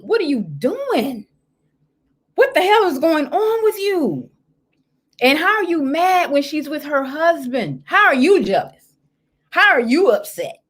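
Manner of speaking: high, disbelieving questions; how are you jealous, said in disbelief; incredulous scolding. Real, not acted.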